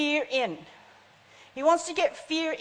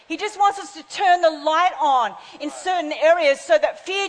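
raised, emphatic pulpit voice; preaching; 3 semitones higher, 5 dB louder than usual. Real, not acted. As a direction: drive each phrase home to the congregation. Preaches with rising force.

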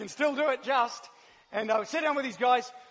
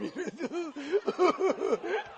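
high, creature-like sobbing whimpers; Gollum; 5 semitones higher than usual. Retold or imitated. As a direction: pretend to sob in small rising gasps. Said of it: "I was like Gollum".

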